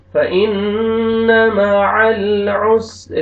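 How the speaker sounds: whole verse chanted, drawn out; slow recitation. Shown as a demonstration. Teacher recites the full verse for students. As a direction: intone the verse slowly like a reciter.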